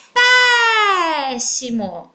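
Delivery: PÉ in "péssimo" held very long and loud; "péssimo" with a hugely lengthened PÉ, then a quick fall; stretched stressed syllable. Shown as a demonstration. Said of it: "foi pronunciada durante mais tempo".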